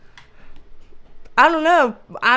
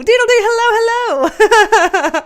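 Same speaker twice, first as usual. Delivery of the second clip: high, loud, giggly hello; excited greeting; 9 semitones higher, 6 dB louder, slower than usual. Real, not acted. Host greeting a viewer with a fun name, excited and high. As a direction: sing out the greeting through a laugh.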